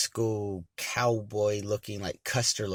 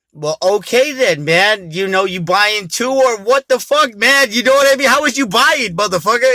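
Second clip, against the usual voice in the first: loud, high, mocking anger; heated retort; 12 semitones higher, 15 dB louder than usual. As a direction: throw it back at him, loud and high. Arguing with the stranger.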